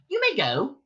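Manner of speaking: high, clipped mouse order; curt dismissal. A narrator's reading. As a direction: dismiss him coolly.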